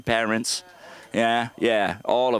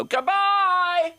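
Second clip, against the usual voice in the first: high, drawn-out wailing goodbyes; high sing-song mock farewell; mom's dramatic goodbye; much slower than usual. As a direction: ham up a dying mother waving farewell. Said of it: "and go".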